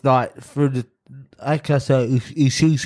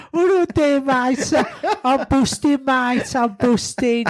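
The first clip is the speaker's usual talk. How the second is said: put-on broad comic Brummy accent, much higher; 11 semitones higher than usual. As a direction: ham up a Birmingham accent. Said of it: "I thought I was talking to Frank Skinner".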